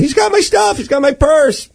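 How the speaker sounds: imitated high-pitched cry of alarm; bellowing woman. Robbed woman points out the thief to a stranger, imitated bellowing. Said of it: "a woman just, just bellowing".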